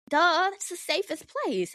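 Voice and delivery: high, slow duh of course; sarcastic obviousness; mock obvious duh. Real, not acted. Self-evident aside to viewers.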